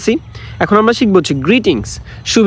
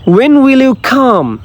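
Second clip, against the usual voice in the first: much higher, very slow scolding question; slow model English; 7 semitones higher, much slower than usual. Shown as a demonstration. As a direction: ask it drawn out, pitched way up. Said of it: "accent দিয়ে দিয়ে পড়বেন". (খুব উঁচু স্বরে ধীরে প্রশ্ন)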